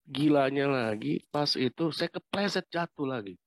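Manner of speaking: normal talking voice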